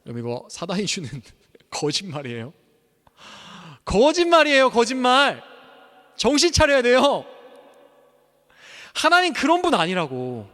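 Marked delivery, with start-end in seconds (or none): 3.9-10.5 much higher and louder rebuke; preacher's full-force outcry; fiery shouting. Real, not acted. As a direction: shout the warning to wake them. Preacher cries out against lies.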